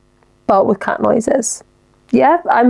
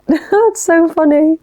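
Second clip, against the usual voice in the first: laughing through the words; real laugh, high and slow; giggling it out; 7 semitones higher, slower than usual. Real, not acted. Laughing at the Bluetooth bit.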